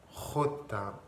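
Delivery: "jota" opening on a rasped throat sound; deep throat jota. Shown as a demonstration. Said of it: "mais plus profond". (« jota » avec un R plus profond, en gorge)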